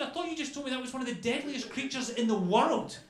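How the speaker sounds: high, loud disbelief; stunned protest. Acted. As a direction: challenge him, completely incredulous.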